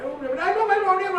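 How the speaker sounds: loud, high mock-panicked blurting; hammed-up panic, pitch and volume climbing; panicked outburst. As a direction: imitate someone flustered, voice shooting up high. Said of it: "like, oh, panic already".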